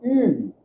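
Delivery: "ü" tight, rounded; soft vowel demo. Shown as a demonstration. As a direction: squeeze "ü" out softly. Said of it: "becomes even a tighter sound".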